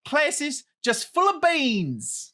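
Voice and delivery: loud, high Australian accent; hammed-up Australian, big and bright. Shown as a demonstration. Roommate story told in an Australian accent. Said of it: "I'm doing Australian".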